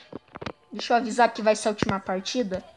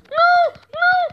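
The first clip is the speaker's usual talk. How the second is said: very high, louder panicked cry; 4 dB louder than usual. Real, not acted. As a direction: scream as you get caught. Dying in game.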